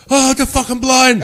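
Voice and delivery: high, loud mock panic; imitated panic. Prank victim waking up thinking he's blind, panicked yelling. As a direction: blurt it out like a man suddenly in a panic. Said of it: "he started panicking".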